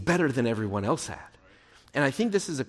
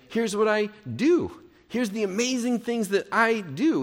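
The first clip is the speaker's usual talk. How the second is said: mildly boastful, higher-pitched self-praise; self-congratulation, lightly acted; mock boasting; 7 semitones higher than usual. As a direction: show off to everyone listening, pitched up. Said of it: "how great he is".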